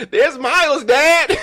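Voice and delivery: high, excited put-on voice calling out; coworkers calling out. Coworkers spotting his son on screen, calling out in a high excited voice. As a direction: squeal it like you've just spotted someone famous.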